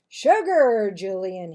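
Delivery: loud demand for sugar; father loudly calling out. Acted. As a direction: call for it loudly like a busy cook.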